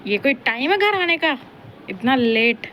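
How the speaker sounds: loud, playful scolding at the latecomers; mock scolding. Scolding family who came home late, in mock anger. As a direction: pretend to be the strict one waiting at the door.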